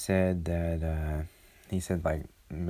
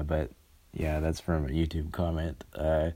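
ordinary talk, nothing marked

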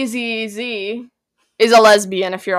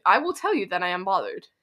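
ordinary talk, nothing marked

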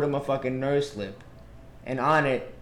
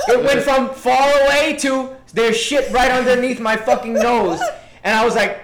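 loud, high-pitched, animated retelling; worked-up disgust; 6 semitones higher, 6 dB louder than usual. Real, not acted. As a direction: tell the gross climax with laughing outrage. Climax of disgusting smell story.

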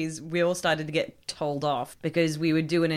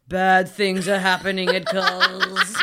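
slow, menacing retelling; a slow horror film threat; ominous store clerk voice; threatening creepy clerk; 6 dB louder than usual. Retold or imitated. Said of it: "felt a bit threatening".

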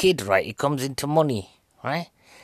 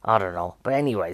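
normal talking voice

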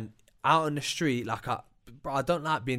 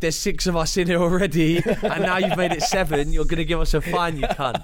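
mock angry rant; 4 semitones higher, 7 dB louder than usual. Acting out telling off officials.

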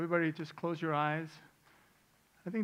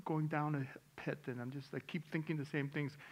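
quiet; 8 dB quieter than usual. Retold or imitated. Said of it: "I've heard people say".

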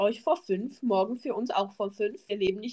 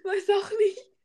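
stifled giggles; 10 semitones higher than usual. Real, not acted. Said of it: "Hör auf zu lachen".

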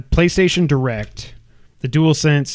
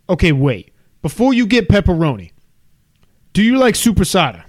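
higher mock New York pizza man; New York accent; 3 semitones higher, 3 dB louder than usual. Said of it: "This is how he talks".

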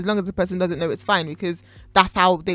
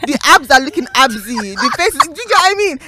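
excited; 7 semitones higher, 8 dB louder than usual. Acted. Excited listing of dream man.

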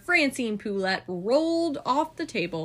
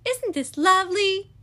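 gushing hostess Stella; 10 semitones higher, 4 dB louder than usual. A narrator's reading. Stella gushing at tea party.

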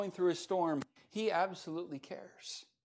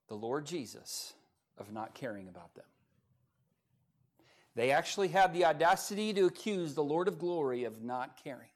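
normal talking voice

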